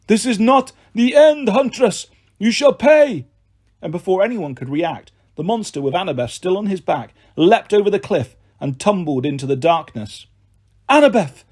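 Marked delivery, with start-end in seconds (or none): 0.1-3.2 high, loud wailing beast; wailing monster threat. Acted. The wounded manticore wails a threat at the huntress, high and loud. 10.9-11.4 high, loud call; yelling a name. Acted. The boy yells to his friend falling off the cliff, high and loud. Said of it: "I yelled".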